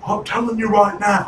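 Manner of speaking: high-pitched British wrestler impression; William Regal. Co-host imitating a wrestler, high British impression. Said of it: "William Regal".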